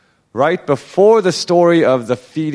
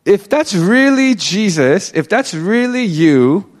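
slow, raised, doubting challenge; skeptical; 4 semitones higher, slower than usual. Retold or imitated. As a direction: voice Peter testing whether it is really him. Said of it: "maybe he was being, uh, skeptical".

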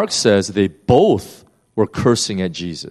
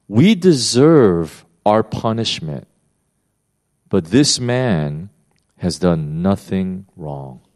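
slow solemn reading; 3 semitones lower, slower than usual. Retold or imitated. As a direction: let each word land slowly and gravely. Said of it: "look what he says".

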